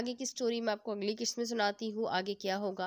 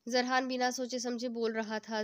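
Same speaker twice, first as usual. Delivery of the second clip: usual speaking voice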